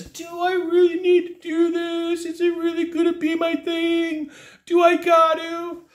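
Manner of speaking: high, whining hesitant customer; whiny homeowner. Retold or imitated. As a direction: plead like you don't want to commit. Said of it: "the buddy close on their end is".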